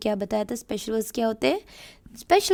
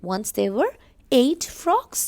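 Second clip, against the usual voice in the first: slow storybook reread; teacher rereading the opening line for the class; storytelling read; 4 semitones higher, much slower than usual. Acted. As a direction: read it again slowly for children.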